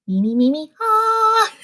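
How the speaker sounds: sung warm-up syllables then a high sliding ah; vocal warm-up. Shown as a demonstration. Streamer resting voice between chapters, quick vocal warm-up. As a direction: loosen the voice with me-me-me. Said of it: "I did some vocal warm-ups".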